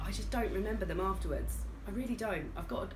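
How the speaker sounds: ordinary talk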